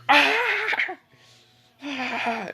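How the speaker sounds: very high held shriek; exaggerated cartoon scream of agony; mock pained scream. Kankuro as his other arm breaks, mock shriek.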